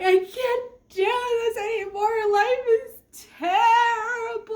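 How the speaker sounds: high, slow, wailing cry; mock sobbing. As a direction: wail it through fake tears. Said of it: "tears flowing and snot mixing in".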